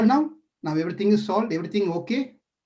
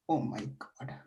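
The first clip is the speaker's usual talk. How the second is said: whispered oh my God; a stunned whisper of dismay; breathy gasp; 4 semitones lower, 11 dB quieter than usual. Acted. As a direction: gasp it under your breath. Shocked reaction to confession.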